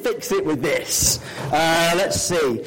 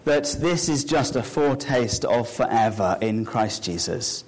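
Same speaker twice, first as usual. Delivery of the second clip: prayer; 4 semitones lower than usual. Acted. Pastor leading the congregation in prayer, reverent low voice. Opening prayer before children's talk.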